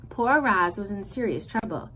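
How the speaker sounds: plain reading voice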